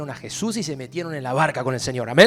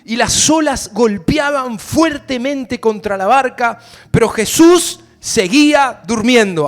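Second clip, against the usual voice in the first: loud, slow, higher pulpit shout; storm preaching; 6 semitones higher, 10 dB louder, slower than usual. Acted. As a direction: drive the storm home, stretching the words.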